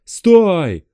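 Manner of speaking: sharp loud high yell; panicked shout. Acted. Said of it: "кричал он в панике".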